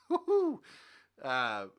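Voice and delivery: ordinary talk, nothing marked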